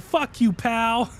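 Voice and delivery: slow, high mock insult; hammed-up imitation of an insult; quoting Clint. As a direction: drag out the insult, amused. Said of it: "And he said".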